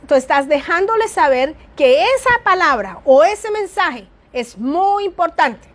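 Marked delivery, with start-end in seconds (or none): 4.3-5.7 muy importante slowed and lifted on muy; stress on muy. Shown as a demonstration. Teacher demonstrating intonation for emphasis. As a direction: lean on muy to show importance. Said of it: "Cuando tú entonas".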